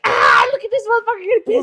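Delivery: high, loud taunting yell; mock gleeful jeer. Kid laughing at the pissed-off adult, loud mocking jeer. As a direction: crow loudly at his anger. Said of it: "he's all laughing his ass off".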